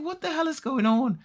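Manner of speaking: very high, bewildered squeak; mock bewilderment. Boy being hurried off without explanation, high-pitched bewilderment.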